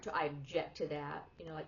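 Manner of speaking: usual speaking voice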